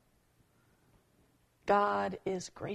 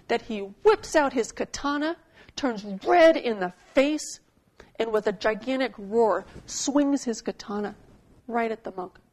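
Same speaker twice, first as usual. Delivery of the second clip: preaching at full force; 2 semitones higher, 7 dB louder than usual. Acted. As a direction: build the rage of the story as you preach. Preacher tells samurai story.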